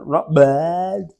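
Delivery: a mock bored "Not bad" with a falling bleh. Mimicking the stock greeting reply, mock unimpressed. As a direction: flatten out "Not bad" like a shrug. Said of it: "There's a, there's a sort of a bleh".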